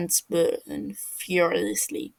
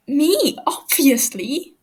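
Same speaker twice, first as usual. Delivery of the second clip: higher, louder, drawn-out cry; pained blurted answer; 6 semitones higher, 8 dB louder, slower than usual. Acted. Captive Fofo cries out the answer under Techno's weight, loud. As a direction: blurt it out in pain.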